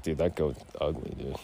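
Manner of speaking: low, quiet scoffing; quiet dismissive put-down; mock scorn. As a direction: sneer at the goat under your breath. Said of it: "And I was like".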